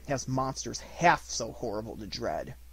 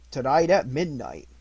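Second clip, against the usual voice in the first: grave spirit voice, louder; loud solemn ghost; 2 semitones higher, 7 dB louder than usual. Acted. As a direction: boom out a solemn warning. Ghost warns Scrooge of midnight.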